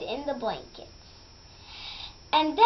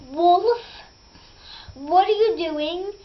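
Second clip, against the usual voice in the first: loud, higher challenge to the wolf; confronting shout; 4 semitones higher, 5 dB louder than usual. Acted. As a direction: call the wolf out sharply.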